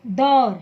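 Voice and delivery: दौड़ said loud and firm as the example; example word called out. Shown as a demonstration. Teacher gives the class the example word दौड़ in a loud, firm voice. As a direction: announce दौड़ clearly to the class. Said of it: "एक शब्द मैं लेती हूँ".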